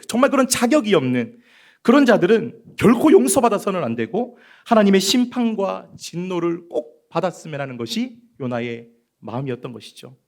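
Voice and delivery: ordinary talk, nothing marked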